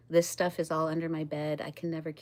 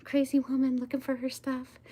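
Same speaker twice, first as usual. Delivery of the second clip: mocking aliens; 7 semitones higher than usual.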